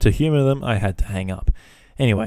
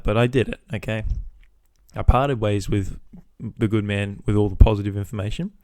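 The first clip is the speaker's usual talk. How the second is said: ordinary talk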